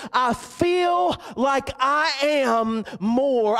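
slow, high, awed inner voice; eaglet's inner resolve. Acted. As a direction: dawn on him slowly, with wonder. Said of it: "he said to himself".

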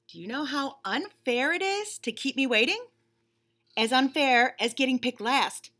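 lightly voiced bunny, impatient and fed up; a put-upon bunny stuck waiting; bored bunny. Bunny complaining to the reader about waiting, mildly whiny.

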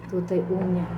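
ordinary talk

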